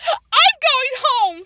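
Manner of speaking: high, drawn-out tearful wail; bawling. A narrator's reading. Sandra quits the party after insults, bawling. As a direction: wail it slowly like an upset child. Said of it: "Sandra bawled".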